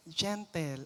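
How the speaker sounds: softly, gently said; gentle. Shown as a demonstration. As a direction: say it soft and gentle like a parent.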